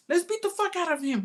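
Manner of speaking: egging on the fight; drunk uncle. Retold or imitated. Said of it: "He's like".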